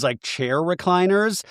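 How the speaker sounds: normal talking voice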